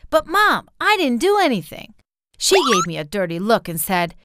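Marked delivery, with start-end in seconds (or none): 0.1-1.9 put-on high-pitched child's protest. A narrator's reading. Daughter tells Mom it is unfair, protesting. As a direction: plead innocence with the pitch up. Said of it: "so I protested".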